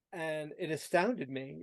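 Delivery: normal talking voice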